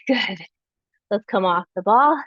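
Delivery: ordinary delivery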